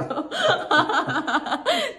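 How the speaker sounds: loud real laugh. Real, not acted. Teacher laughing at student's counting joke, loud laugh. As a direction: burst out laughing.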